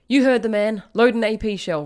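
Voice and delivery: urgent shout to the loader; yelled order. A narrator's reading. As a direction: bellow it at the tank crew. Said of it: "Rivers yelled".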